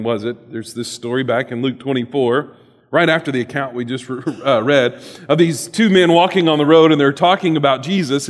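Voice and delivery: ordinary delivery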